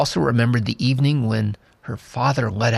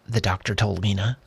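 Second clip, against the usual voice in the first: usual speaking voice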